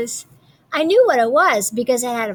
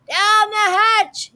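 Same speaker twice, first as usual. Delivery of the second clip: slow, higher crew shout; all shouting; 10 semitones higher, 4 dB louder, much slower than usual. A narrator's reading. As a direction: shout it back together. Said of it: "we all shouted".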